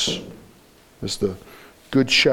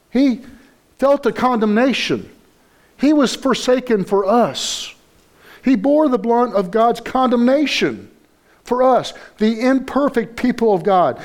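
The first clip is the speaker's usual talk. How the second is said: higher, impassioned preaching voice; fervent preaching; 7 semitones higher than usual. Acted. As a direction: lift it up, preach it with fire. Preacher rising to full force.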